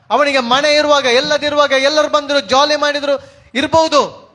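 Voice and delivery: declamatory, pressing sermon delivery; impassioned preaching. Acted. Preacher recounting how friends abandoned and accused Job, impassioned. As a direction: pile up the grievances with mounting insistence.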